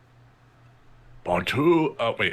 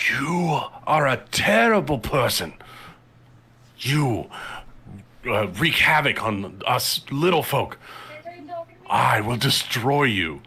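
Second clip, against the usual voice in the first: giant voice; 5 semitones higher than usual. Acted. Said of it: "she'll speak in giant".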